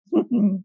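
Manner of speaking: light high laugh; brief real chuckle. Real, not acted. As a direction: laugh out mid-sentence. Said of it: "I'm laughing".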